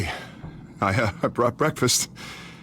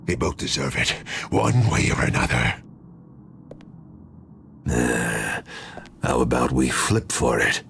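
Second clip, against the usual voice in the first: much lower, cold mocking voice; sinister alter ego; 13 semitones lower than usual. Split personality mocking his rival, low cold sneer. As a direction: drop low and sneer like a second self.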